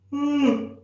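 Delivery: a big, high, open yawn sound; demo yawn. Shown as a demonstration. Teacher yawning aloud to show the class. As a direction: yawn out loud as a demonstration. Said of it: "I want you to yawn".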